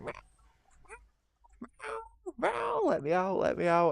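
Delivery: high whiny mock cat voice; voicing the cat. Owner voicing his cat begging at the door in a high whine. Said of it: "and he's like".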